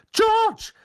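high, loud warning shout; sharp reprimand. A narrator's reading. Mother catches her son out, sharp high shout. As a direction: cut in sharply with the son's name. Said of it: "sagte Misses Weasley scharf".

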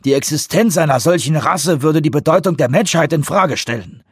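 emphatic, alarmed objections; officials in heated debate. Acted. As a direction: argue urgently against going public.

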